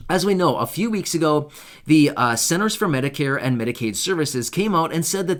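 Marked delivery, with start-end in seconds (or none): none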